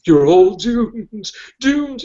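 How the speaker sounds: high, slow ominous drawl; Crazy Ralph impression. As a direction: croak the doom warning slowly. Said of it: "Remember Crazy Ralph?".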